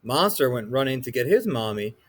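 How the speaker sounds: ordinary delivery, nothing marked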